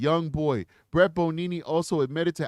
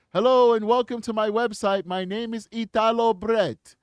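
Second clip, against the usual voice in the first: a light mock Italian accent, a bit higher; lightly put-on Italian accent introducing himself; fake Italian accent; 6 semitones higher, 6 dB louder than usual. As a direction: read the bio in a hammed-up Italian accent. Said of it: "That's my fake Italian voice".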